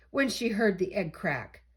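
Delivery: usual speaking voice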